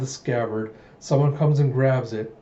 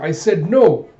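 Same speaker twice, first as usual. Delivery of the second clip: louder, higher mock outburst; insistent refusal; 5 semitones higher, 8 dB louder, slower than usual. King overruling a reluctant guard, insistent and raised. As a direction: cut in with an emphatic, raised refusal.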